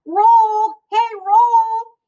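loud high call to the roll; calling the roll like a dog. Acted. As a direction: call it like a pet. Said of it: "she's calling it".

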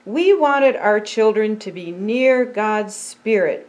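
ordinary delivery

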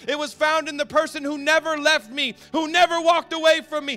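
high, loud preaching, slowing on not my earthly father; full-force preaching. Acted. Preacher telling the congregation where his identity was found, full-force preaching. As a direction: proclaim it like the turning point of your life.

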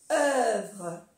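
"œuvre" con œ redondeada y r francesa; pronunciación francesa. Shown as a demonstration. ("œuvre" pronunciado a la francesa)

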